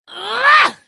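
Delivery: loud, high strangled cry of anger; a burst of pure fury; furious grunt of rage. Acted. Daughter raging at her father's killer, furious grunt.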